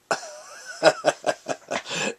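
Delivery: long chuckle after the punchline; laugh at own joke. Real, not acted.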